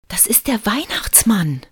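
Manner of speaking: high-pitched triumphant announcement. A narrator's reading. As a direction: proclaim it like an excited child proved right. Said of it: "verkündete Lukas triumphierend".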